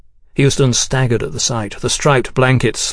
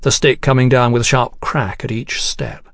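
ordinary delivery

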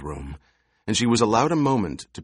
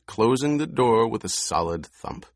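plain reading voice

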